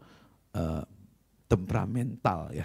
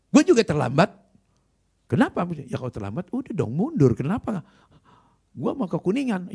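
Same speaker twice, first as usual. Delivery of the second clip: loud, high, rude back-and-forth; heated quarrel; 8 semitones higher, 7 dB louder than usual. Retold or imitated. Rival pastor arguing loudly with the preacher. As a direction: argue like an annoyed pastor.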